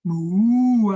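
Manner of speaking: loud, drawn-out spooky moo; ghostly moo. Acted. Cow ghost moos at the farmer. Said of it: "I'm a cow ghost".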